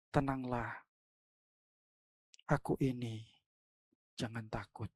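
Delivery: calm them softly like a shepherd; tender reassurance. Acted. Jesus voiced, after panic quote.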